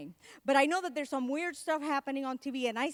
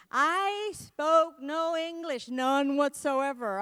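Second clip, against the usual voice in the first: slow and deliberate, every word weighed; emphatic; 5 dB louder, much slower than usual. Real, not acted. Telling the audience slowly and firmly how little English he had.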